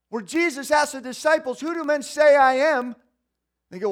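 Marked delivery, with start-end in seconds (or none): 1.6-3.0 retold question. Jesus asks the disciples.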